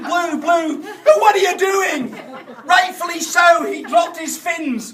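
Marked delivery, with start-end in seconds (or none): 0.0-2.1 high, loud shocked yell at a friend; retold shout. Shouting at Blue through the door, appalled and loud. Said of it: "I shouted".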